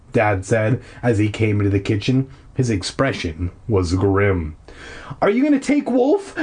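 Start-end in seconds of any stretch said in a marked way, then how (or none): 5.2-6.3 high, urgent question; lightly acted demand, pitch jumping up. Boy demanding an answer from his dad, urgent. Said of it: "I demanded immediately".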